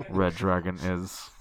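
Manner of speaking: low, slow sinister command; serial killer voice. Acted. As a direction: intone it like a cult killer. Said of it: "I will not do that voice again".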